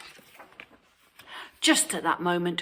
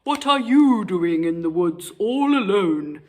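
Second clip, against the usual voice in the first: wolf voice; 5 dB louder than usual. A narrator's reading. Wolf speaks.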